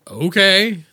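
loud eager agreement; excited okay. Retold or imitated. Accepting a friend's free ticket offer with loud eager agreement. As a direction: jump at the offer. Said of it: "And I'm like".

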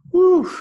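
loud high laugh, then a big relieved whew. Real, not acted. Relief after the misunderstanding.

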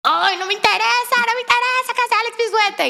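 high, whining protest; irritated at being woken. Retold or imitated. Daughter just woken by her fan mother, irritated whine. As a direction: whine it in a put-on cranky voice. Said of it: "lo que peor que me pueden hacer a mí es despertarme".

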